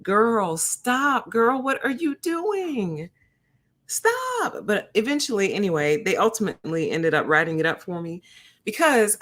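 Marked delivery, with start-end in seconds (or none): 0.0-4.7 rising to a very high, slow "Stop"; high mock scolding. First to scold the nurse, climbing high mock scold. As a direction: scold her, climbing higher to the last word. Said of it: "first person to tell her".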